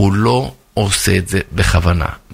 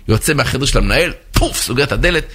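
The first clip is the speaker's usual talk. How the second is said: ordinary talk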